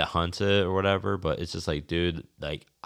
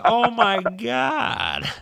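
much higher, louder, drawn-out disbelieving cry; shocked outburst; 8 dB louder, much slower than usual. Retold or imitated. Spotting a rider on a horse during season, retold as a loud stunned cry. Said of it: "I'm like".

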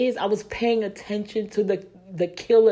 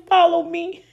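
high, louder, fervent voice of God calling; God pleading; 7 semitones higher, 4 dB louder than usual. Acted. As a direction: plead as God reaching out a hand. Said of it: "he's saying".

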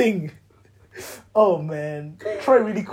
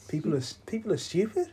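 Irish accent; 6 dB quieter than usual. Shown as a demonstration. Echoing friend's Irish accent attempt, Irish lilt. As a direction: copy the Irish lilt quietly, ending upward. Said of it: "that was my Irish accent".

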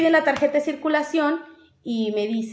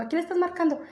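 higher, softer mock indignation; retold annoyed policeman; 5 semitones higher, 6 dB quieter than usual. Cop questions her phone call, mildly annoyed. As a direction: lightly imitate a cop demanding an answer. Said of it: "se enojó porque empecé a marcar".

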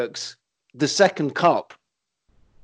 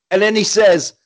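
ordinary delivery

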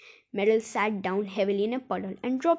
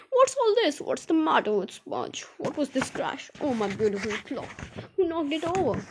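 flustered, cross exclamations; alarmed outburst; 4 semitones higher than usual. A narrator's reading. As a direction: burst in demanding what happened. Dame Grumble rushes in to a crash.

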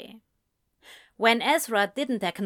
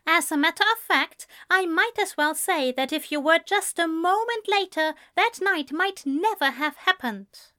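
high-pitched, emphatic argument; indignant Ezra; 8 semitones higher than usual. A narrator's reading. Man tells friend that night was chance, indignant. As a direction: press the point in a high voice.

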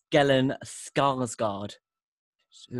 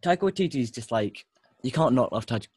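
usual speaking voice

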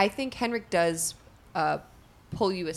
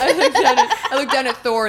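talking through a big laugh; high, loud, giggling narration; 7 semitones higher, 11 dB louder than usual. Real, not acted.

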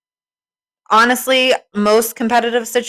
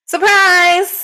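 excited shout; 9 semitones higher, 4 dB louder than usual. Acted. Friends arrive as surprise.

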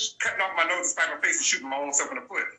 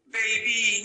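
higher, mock pimp croon; fake pimp voice; 6 semitones higher than usual. Host mocking wannabe pimps, pitched-up mock croon. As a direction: ham up a wannabe pimp's "Baby". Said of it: "you know, talking like this".

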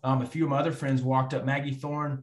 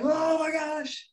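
much higher, slow, drawn-out shout of joy; going nuts; much slower than usual. Real, not acted. He goes nuts after the buzzer, shouting. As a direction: lose it with pure excitement. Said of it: "as I go nuts".

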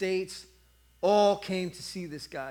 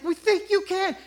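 crowd shouting back; 13 semitones higher, 7 dB louder than usual. Retold or imitated. Crowd answering Blondin.

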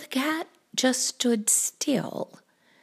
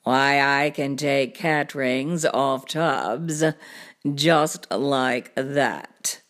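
low, slow cartoon cat voice; deep, leisurely show-off cat; boastful cat; 9 semitones lower, slower than usual. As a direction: brag slowly, deep and easy.

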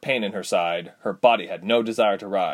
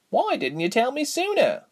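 put-on young heroine voice. Reshma worried question.

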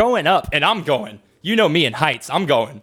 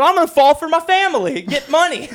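much higher, giddy yelled joke; daredevil joking; 9 semitones higher than usual. Retold or imitated. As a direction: shout it as a reckless thrill-seeker. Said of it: "he's going".

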